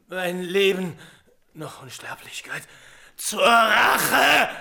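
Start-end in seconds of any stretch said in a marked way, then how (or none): 3.2-4.5 higher, louder outcry for vengeance; cry for revenge. Acted. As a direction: burst out with the word. Dying Guise cries for revenge.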